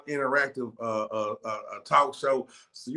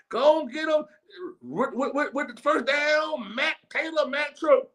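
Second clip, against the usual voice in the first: high, slow hype call from the booth, pitched up; stadium announcer; 9 semitones higher than usual. Pretend. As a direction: pump up the crowd like the PA voice at a first down. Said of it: "I'm gonna be in the booth, boy. Talking about".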